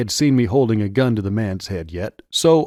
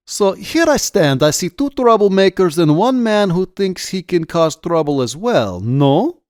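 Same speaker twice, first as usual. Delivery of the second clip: put-on high, loud Russian-accented officer; 8 semitones higher, 6 dB louder than usual. A narrator's reading. Officer sizes up three troublemakers, in a Russian accent. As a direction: lecture them loudly in a Russian accent. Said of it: "he asked in a heavy Russian accent".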